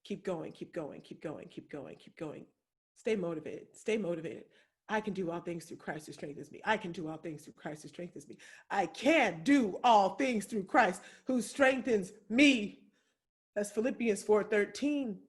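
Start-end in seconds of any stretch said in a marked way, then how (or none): 0.0-8.4 very soft, low murmured mantra; whispered inner voice. Retold or imitated. Singer alone, urging herself on: hushed low murmur. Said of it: "just thinking". 8.7-12.8 slow declaration. Real, not acted. Declaring the verse after murmurs.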